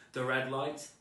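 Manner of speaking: plain reading voice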